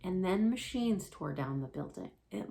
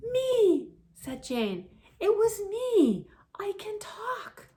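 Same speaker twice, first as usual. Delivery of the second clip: very high, loud and slow; excited little voice; 8 dB louder, slower than usual. Acted. Jane telling her siblings she can talk, very high and excited. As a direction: say it in wonder and pride. Jane proudly speaking first time.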